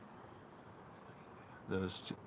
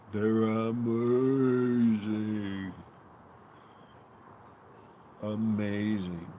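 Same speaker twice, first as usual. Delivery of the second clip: enthusiastic; 8 dB louder, slower than usual. Real, not acted. Raving about the drink.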